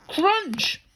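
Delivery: high, punchy crunch sound effect; spoken table-breaking crunch; imitated crash. A narrator's reading. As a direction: hit it like a comic-book effect. Table breaking under the teacher.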